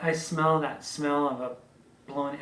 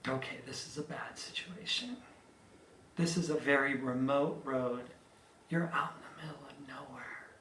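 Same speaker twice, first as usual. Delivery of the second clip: hushed whisper to herself; inner thought whispered; 6 dB quieter than usual. Retold or imitated. Trapped driver whispering to herself after the crash. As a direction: whisper it, taking stock of the danger. Trapped alone in wrecked car.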